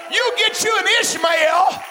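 high, loud shouted exhortation; impassioned full-force preaching. Real, not acted. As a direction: drive it to a shouting, rejoicing peak.

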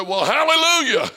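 high, loud hallelujah shouted back; hollered back. Retold or imitated. Brother Holmes shouting hallelujah back from the stretcher. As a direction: throw the hallelujah right back.

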